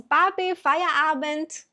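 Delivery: put-on high, sing-song child calling papi; daughter's voice. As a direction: imitate a little girl calling her dad. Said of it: "elas vão lá e falam assim". (filha chamando o pai, voz aguda e cantada)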